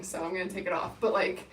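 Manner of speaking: ordinary delivery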